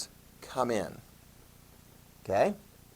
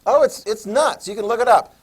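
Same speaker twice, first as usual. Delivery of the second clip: higher and louder, marveling at "nuts"; teacher amazed at border fights; excited disbelief; 7 semitones higher, 6 dB louder than usual. Real, not acted. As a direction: sell how wild it is. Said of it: "It's crazy".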